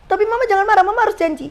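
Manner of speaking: high-pitched retold begging; anxious pleading. Daughter begs mother to promise not to be angry, anxious pleading.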